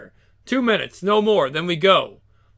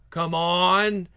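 ordinary delivery

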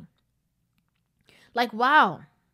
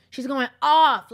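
usual speaking voice

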